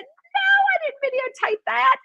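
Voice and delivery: high-pitched, indignant retort; emphatic denial. Retold or imitated. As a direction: shoot down the very idea, retold. Indignant answer to sister.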